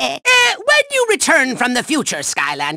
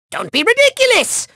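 ordinary talk

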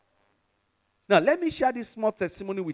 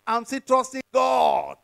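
slow preacher's shout; rousing pulpit cry; emphatic declaration; 3 semitones higher, 3 dB louder, slower than usual. Real, not acted. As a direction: proclaim it, stretching out the last word. Preacher's climactic declaration of faith.

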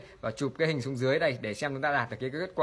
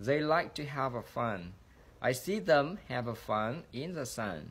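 softer, measured sentence-by-sentence reading; model reading; 4 dB quieter than usual. Shown as a demonstration. Teacher reads a sample for students to repeat, careful model reading. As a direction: read each sentence carefully and softly, pausing between. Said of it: "tôi sẽ đọc mẫu cho các anh chị".

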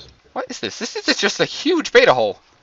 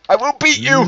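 high villain roar, half playful; roaring tyrant king; 8 semitones higher than usual. Pretend. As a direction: bellow it like a mock tyrant. Chasing friend as mock king.

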